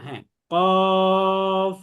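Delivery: কফ held very slow and loud for three alifs; তিন আলিফ টেনে কফ; qaf drawn long. Shown as a demonstration. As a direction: drag কফ out three counts. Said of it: "বলেন".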